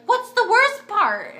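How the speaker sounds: alarmed question. Retold or imitated. To nurses who say the worst is over, a high loud alarmed question. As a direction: ask it like the floor just dropped.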